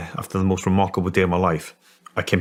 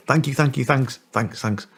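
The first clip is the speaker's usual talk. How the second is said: high, repeated thanks, imitating a passenger; effusive thanks; 6 semitones higher than usual. Passenger thanking the driver who dropped him off, effusive. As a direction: reel off light, high-pitched thanks. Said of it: "Back in his normal voice".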